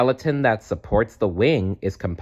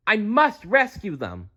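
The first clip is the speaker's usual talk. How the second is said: urgent; 13 semitones higher than usual. Acted. Urgent rescue resolve.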